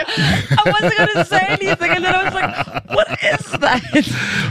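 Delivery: squeaky high words through laughter, slowed; real helpless laughter, last words barely voiced; laughing hard. Real, not acted. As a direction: gasp the words out while cracking up. Shrieking laughter at the joke.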